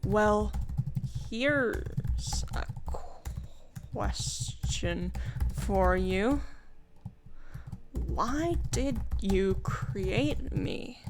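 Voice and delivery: slow, hesitant little creature voice; a lightly voiced small dragon, lost and unsure; puzzled creature drawing each word out slowly; timid cat dragon. Player voices cat dragon asking.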